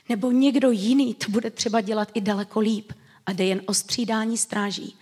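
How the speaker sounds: ordinary talk, nothing marked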